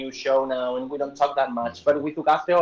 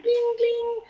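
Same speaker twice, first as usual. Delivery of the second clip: high playful ding ding; mock bell ding. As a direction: chime it like a game show bell. Jokey bell after repeated word.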